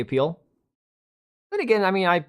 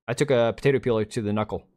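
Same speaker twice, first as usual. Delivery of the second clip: ordinary talk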